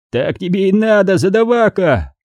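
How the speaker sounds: high-pitched gloating taunt; snickering jeer. Acted. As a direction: tease with a touch of snicker. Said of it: "прыснул лось". (злорадная дразнилка)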